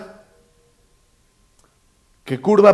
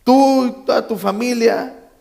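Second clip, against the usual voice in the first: higher-pitched mock-angry comeback; acted angry retort; 7 semitones higher than usual. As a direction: throw the insult back, voice raised in pitch. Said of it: "El otro viene enojado".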